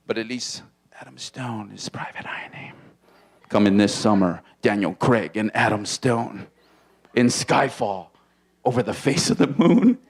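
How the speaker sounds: low, dramatic movie-trailer voice; deep trailer voiceover; trailer announcer. Pretend. Trailer voice, whispered start.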